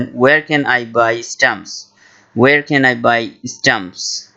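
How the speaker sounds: very slow, careful English; slow model phrase. Shown as a demonstration. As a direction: say it slowly for learners. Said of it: "ইংলিশে বলবেন". (ধীরে ধীরে স্পষ্ট উচ্চারণে)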